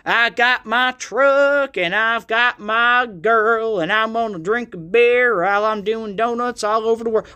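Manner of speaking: put-on sing-song parody of a country accent; mock country-singer twang. Fake country boy from Boston singing, exaggerated Southern twang. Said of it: "suddenly has an accent when he's singing".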